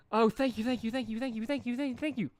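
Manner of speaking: overjoyed pony babbling thanks, pitched high; excited gushing. A narrator's reading. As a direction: gush thanks, rising each time. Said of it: "Derpy's eyes widened".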